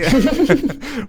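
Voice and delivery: high, rising burst of laughter; big laugh. Real, not acted. Host laughing after mock Let's Play greeting, rising laugh. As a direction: laugh at your own joke.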